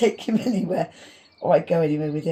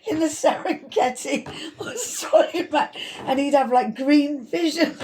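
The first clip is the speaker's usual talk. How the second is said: high, giggling through every word; laughing too much to talk straight; laughing hard; 10 semitones higher than usual. Real, not acted. Joking about night-vision Attenborough, laughing hard. Cracking up at own joke.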